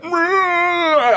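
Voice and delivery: long, very high-pitched "mrrr"; high droning mock noise; imitated drugged groan. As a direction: mimic someone zoned out on a hit. Imitates person checked out on drugs.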